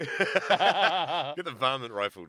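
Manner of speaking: slow, loud western twang; hammed-up slow, loud Old West voice; cowboy drawl. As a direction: play a grizzled cowboy. Cowboy voice mocking.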